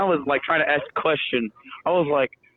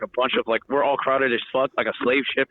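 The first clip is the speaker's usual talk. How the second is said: usual speaking voice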